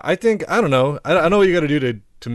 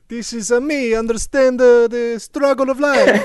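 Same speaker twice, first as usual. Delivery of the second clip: high mock Italian accent; 9 semitones higher than usual. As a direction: plead like a tragicomic Italian. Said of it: "the Italian accent is approaching".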